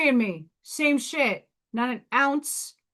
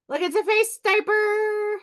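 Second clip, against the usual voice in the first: high excited aside; 11 semitones higher, 4 dB louder than usual. Real, not acted.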